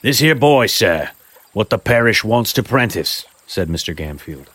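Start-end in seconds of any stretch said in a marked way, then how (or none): 0.0-3.2 raised, loud rustic tradesman voice; a pushy chimney-sweep type selling himself; rough tradesman. Acted. Gamfield offers to take Oliver.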